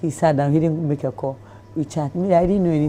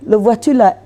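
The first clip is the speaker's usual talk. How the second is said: higher, louder French on the phone; translating in French; 5 semitones higher, 3 dB louder than usual. Retold or imitated. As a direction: speak French politely as a phone interpreter. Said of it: "so I was like".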